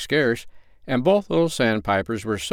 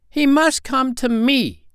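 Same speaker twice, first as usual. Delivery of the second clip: high, slow little bird declaration; stubborn tiny sandpiper; stern; 4 dB louder, slower than usual. A narrator's reading. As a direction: lay it down firmly, word by word, in a piping pitch. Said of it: "he said sternly".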